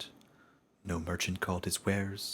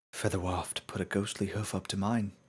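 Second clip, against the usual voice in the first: plain reading voice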